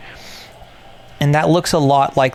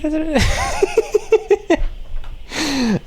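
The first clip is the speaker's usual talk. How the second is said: laughing in a high squeak; high squeaky laugh; high giggle; 13 semitones higher than usual. Real, not acted. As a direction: let a real giggle out. Laughs at own voice.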